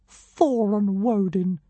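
high, slow, scornful little dragon; derisive snort. A narrator's reading. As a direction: sneer at the names. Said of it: "snorted the creature derisively".

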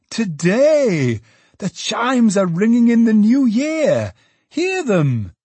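high, loud joyful calling; young man exulting over the chimes; exultant. A narrator's reading. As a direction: ring it out like the bells. Richard exults at the bells.

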